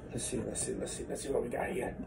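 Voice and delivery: soft muttering under breath; muttering to himself. Real, not acted. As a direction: mutter quietly as you pour. Muttering while pouring drinks.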